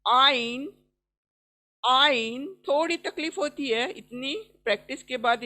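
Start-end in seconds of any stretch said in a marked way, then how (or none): 0.1-2.5 आइन pressed from mid throat; throaty ain. Shown as a demonstration. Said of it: "थोड़ा press करके देखें". (गला दबाकर आइन)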